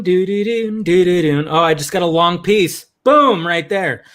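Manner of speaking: usual speaking voice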